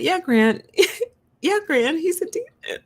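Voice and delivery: put-on high, loud, giggling kid. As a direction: squeak it out like a kid. Retelling own childhood reply.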